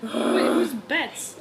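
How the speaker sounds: ordinary talk, nothing marked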